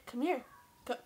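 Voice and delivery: ordinary delivery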